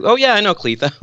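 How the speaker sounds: high delighted oh-I-know-her; excited recognition; dawning realisation. Retold or imitated. Sudden realisation in retold chat.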